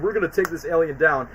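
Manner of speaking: ordinary talk, nothing marked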